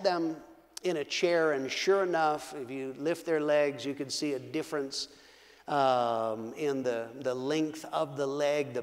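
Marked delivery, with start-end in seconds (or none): none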